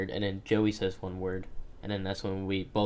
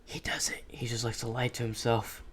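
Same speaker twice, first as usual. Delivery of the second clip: soft breathy whisper; 6 dB quieter than usual. Real, not acted. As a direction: whisper it as an aside. Whispered aside about friend.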